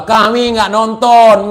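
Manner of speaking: ordinary talk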